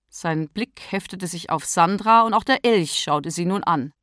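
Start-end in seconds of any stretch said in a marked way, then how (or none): none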